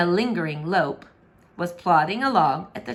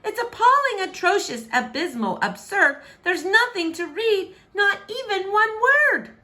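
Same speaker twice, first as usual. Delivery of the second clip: despairing princess; 12 semitones higher, 3 dB louder than usual. Acted. Princess despairs over no books.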